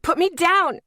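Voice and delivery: loud, high yell. A narrator's reading. Woman yells at man carrying her, loud. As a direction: yell to be released. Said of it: "I yelled".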